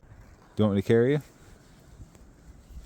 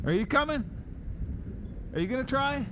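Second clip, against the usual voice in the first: high coaxing voice; sweet high voice for a pet; talking to a dog; 5 dB quieter than usual. Real, not acted.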